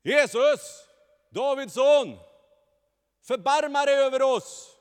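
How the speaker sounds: very high, loud desperate cry. Acted. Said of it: "De skrek ännu högre".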